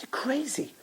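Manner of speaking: breathy whispered scoff. Retold or imitated. Passerby scoffing at the starfish thrower, whispered. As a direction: hiss it under the breath. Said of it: "He said".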